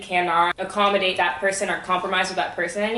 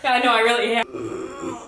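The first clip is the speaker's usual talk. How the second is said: mouth burning from spicy food; 4 semitones higher than usual. Real, not acted. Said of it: "I'm hurting".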